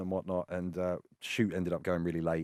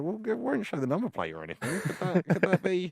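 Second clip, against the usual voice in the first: coaxing plea; 10 semitones higher than usual. Retold or imitated. Wheedling the police for shot.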